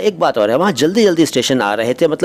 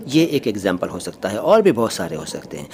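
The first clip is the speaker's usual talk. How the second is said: normal talking voice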